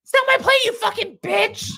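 very high-pitched mock screech; imitation of a shrieked outburst; Shannon yelling. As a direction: screech it like an outraged reality star. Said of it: "all day I've been yelling".

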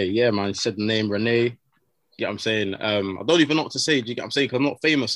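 ordinary delivery

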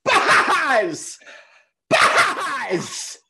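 high, loud call for Buzz; Woody. Pretend. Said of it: "this is my Woody".

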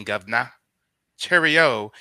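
loud, exaggerated British lilt; mock British accent. Host mocking the U K twang, mock British accent. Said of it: "with that U K twang".

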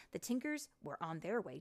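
ordinary delivery

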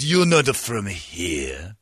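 very slow imitation Korean accent; mock Korean accent. Man telling off an outsider, mock Korean accent. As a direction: draw out each word in a Korean accent. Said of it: "a Korean man with an accent".

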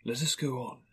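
soft, lightly ghostly voice; hushed spirit's invitation; gentle ghost. Acted. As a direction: quietly bid him onward as a spirit. Ghost bids Scrooge continue.

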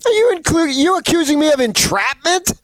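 high-pitched, indignant protest; joking indignation at his co-host's accusation; mock outrage.

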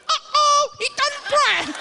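alarmed falsetto squeak; brain's voice. Acted. Brain reacting to husband who won't pray, squeaky alarm. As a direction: play inner alarm in a very high cartoon voice. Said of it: "That's what I'm thinking".

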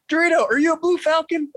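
mock-shout it like a sergeant losing patience. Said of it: "So he's just yelling at me louder".

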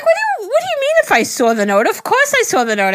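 very high, indignant mock protest; imitating own high-pitched disbelief; retold incredulous reply. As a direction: squeak it out in exaggerated bafflement. Retells baffled reply to partner.

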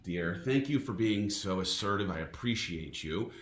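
low, soft and gently mocking; tender teasing. Real, not acted. Dad gently teasing his son over the seat, soft and tender.

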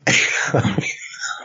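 breathless silent laugh; wheezy laugh. Real, not acted. Co-host jokes about their club.

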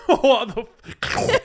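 high, loud laugh breaking the words; laughing hard. Real, not acted. Boyfriend cracks up at her ussy question, loud high laughter. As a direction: lose it laughing mid-swear.

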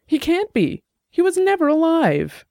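high, tearful denial; almost in tears. A narrator's reading. Suspect breaking down before investigator, tearful. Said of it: "almost in tears".

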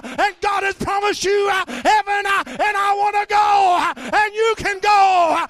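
high, loud, chanted sermon climbing to shouts; full-force preaching. Acted. Preacher reciting the psalm and Caleb's story to the church, roaring. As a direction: preach it hard, building until you're shouting.

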